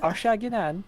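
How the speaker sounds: high mock South London insult; cheeky South London jab; South London accent. Trading mock South London insults, cheeky. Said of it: "South London, yeah?".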